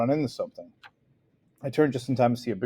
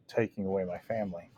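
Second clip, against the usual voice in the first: ordinary delivery, nothing marked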